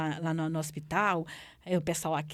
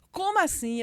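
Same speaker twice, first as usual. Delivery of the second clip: startled cry; 9 semitones higher, 4 dB louder than usual. Retold or imitated. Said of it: "tem um grito do outro lado".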